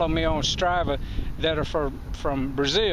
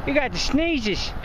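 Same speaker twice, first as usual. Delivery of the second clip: much higher, sing-song voice for animals; pet talk; 8 semitones higher than usual. Real, not acted. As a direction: coo at the llamas like pets. Talking to the llamas.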